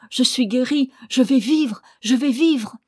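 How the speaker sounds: high, loud cry of joy; joyful cry. A narrator's reading. Totaro crying out to the shark-man that he will live, loud with joy. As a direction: shout it out, cured and overjoyed. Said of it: "Puis il s'écria".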